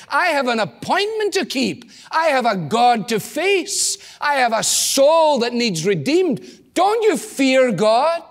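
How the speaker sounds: impassioned preacher voicing the repentant criminal; rising preached plea.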